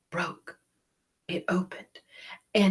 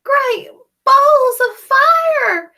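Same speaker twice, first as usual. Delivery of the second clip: very high, loud, slow cowboy gosling; a brash newborn goose; baby goose voice; 15 dB louder than usual. Acted. Gosling greeting Jack as Ma. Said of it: "and said".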